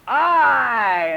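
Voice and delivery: put-on toddler's loud, high, drawn-out announcement; little toddler. Farquhar performing for Grandma, high and loud. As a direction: proudly call out one word like a toddler. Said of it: "And Farquhar goes".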